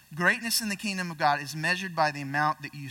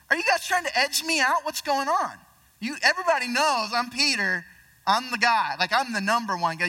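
higher, louder mock-indignant Peter; Peter's indignant protest; 5 semitones higher, 4 dB louder than usual. Peter protests to the others, mock-indignant and raised. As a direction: play a hammed-up, wounded Peter defending his rank. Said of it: "So I'm sure Peter's going".

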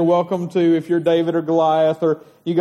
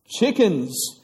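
ordinary talk, nothing marked

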